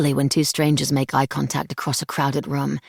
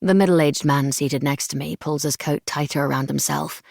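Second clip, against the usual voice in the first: ordinary delivery, nothing marked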